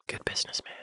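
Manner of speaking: breathy whispered aside; hushed approving aside. Real, not acted. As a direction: whisper it to yourself, half to the camera. Whispered aside after impression.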